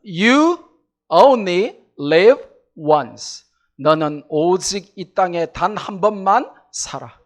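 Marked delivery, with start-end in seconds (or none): 0.0-3.5 each word stretched out very slowly and loud; slow emphatic English. Acted. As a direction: lay out "only live once" word by word, weighty. Preacher spells out YOLO. (천천히 또박또박 힘주어)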